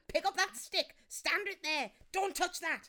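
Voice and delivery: big sister bossing her sibling while building a den; bossy big sister. Retold or imitated. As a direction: order the little one around. Bossy sister giving orders.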